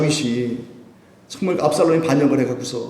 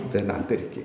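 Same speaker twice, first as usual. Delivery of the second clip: resigned parent; 5 semitones lower, 9 dB quieter than usual. Pretend. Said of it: "부모가".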